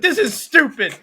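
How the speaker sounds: loud, high mock tantrum of a sore loser; indignant outburst. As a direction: blurt it out, hammed-up and indignant.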